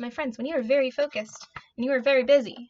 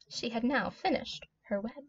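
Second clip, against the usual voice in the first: usual speaking voice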